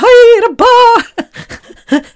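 very high, loud and stretched out slowly; giddy greeting. Retold or imitated. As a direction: squeal it out, starstruck. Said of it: "I'm like".

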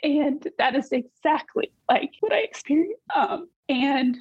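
real emotion rising in the voice; choked up. Real, not acted. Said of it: "I'm gonna get emotional".

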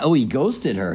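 ordinary delivery

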